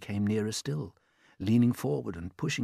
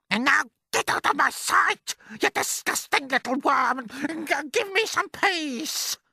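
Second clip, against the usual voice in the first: high, loud, menacing aunt; threatening aunt; 6 dB louder than usual. Acted. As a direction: threaten the boy coldly. Aunt Spiker dismisses James.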